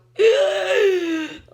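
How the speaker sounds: loud, high, desperate gasp; huge waking gasp. Shown as a demonstration. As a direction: jolt awake gasping for air. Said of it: "like that".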